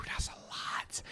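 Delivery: breathy whisper under a sigh; whispered aside. Real, not acted. Friend whispers at a heavy schedule. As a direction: mutter it to yourself, barely voiced.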